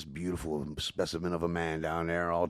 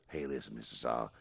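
McConaughey impression; 7 semitones lower, 6 dB quieter than usual. McConaughey explaining no selfies.

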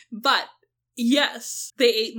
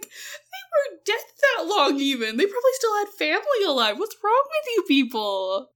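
indignant; 8 semitones higher than usual. Retold or imitated. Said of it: "'cause I'm like".

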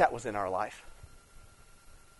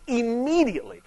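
"Immediately" поднять высоко и ударить отдельно; stressed immediately; 8 semitones higher, 5 dB louder than usual. Shown as a demonstration. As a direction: punch "Immediately" high on its own. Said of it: "the text stresses immediately".

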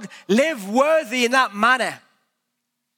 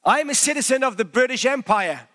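ordinary talk

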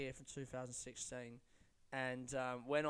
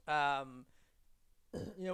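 ordinary delivery

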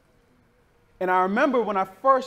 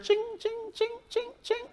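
high-pitched "ching" in even beats; short, high repeated chings; imitated jingling sound; 5 dB quieter than usual. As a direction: mimic a jingle coming up behind, high and ringing. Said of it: "all of a sudden you heard".

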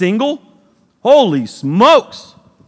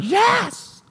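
cheering student; 4 semitones higher than usual. Retold or imitated. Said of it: "You're like".

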